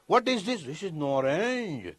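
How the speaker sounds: put-on English accent, drawn-out last word; mock English accent. English speaker naming the fruit, mock English accent. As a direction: imitate an English speaker, stretching "orange".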